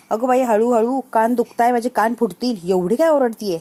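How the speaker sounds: acting out a noisy grandmother's protest; loud complaint. Grandmother complains her ears hurt from the child, shouting in mock protest. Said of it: "तिच्यापेक्षा तू किती जोरात ओरडतीयेस".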